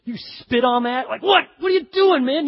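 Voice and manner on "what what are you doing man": loud, high-pitched indignant disbelief; livid outburst. Acted. Day labourer handed his pay, livid. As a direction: act out being worked up and appalled. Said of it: "well, you're livid".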